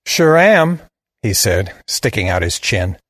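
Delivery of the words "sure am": put-on higher southern boy, defiant; cocky answer in a Southern drawl. A narrator's reading. Boy sticks out his chin at his killer, southern drawl. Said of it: "He had a deep southern drawl".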